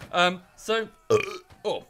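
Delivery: burpy, mid-burp; burping after fizzy drink. Real, not acted. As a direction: talk through a beer burp. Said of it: "I keep burping".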